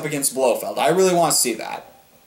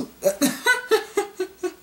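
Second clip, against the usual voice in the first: breathless high-pitched giggling; high giggle. Real, not acted. Giggling at the movie's name.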